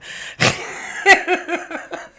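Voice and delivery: loud, high burst of laughter; cracking up. Real, not acted. Reader laughing at her own hanged-man innuendo, cracking up. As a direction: crack up at your own joke.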